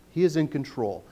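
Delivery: ordinary talk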